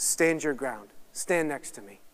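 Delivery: low, hushed, steady voice; softly steady someone who is hurting; tender reassurance. Acted. Jesus speaks to those in pain, tender reassurance.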